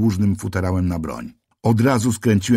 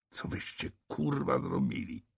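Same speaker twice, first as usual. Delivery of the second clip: a whispered, much softer rasp; rasped through clenched teeth; 5 semitones higher, 14 dB quieter than usual. A narrator's reading. Narrator rasps at the policeman through clenched teeth. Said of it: "wycharczałem przez zaciśnięte zęby".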